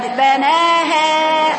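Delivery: "بناها" chanted high and very drawn out as the mistake; demonstrated faulty reading. Shown as a demonstration. Teacher shows the class an error, high drawn-out "بناها". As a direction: show the wrong "بناها", held long and high. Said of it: "خطأ".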